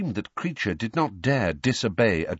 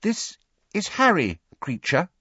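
put-on high, careful girl's voice; tentative; 12 semitones higher, 3 dB louder than usual. Hermione introducing Harry to the elf, tentatively. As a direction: introduce him gingerly, pitched well up. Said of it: "said Hermione tentatively".

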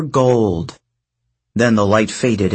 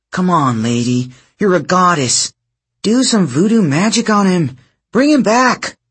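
pleading; 7 semitones higher than usual. A narrator's reading. Leo begging goddess to act.

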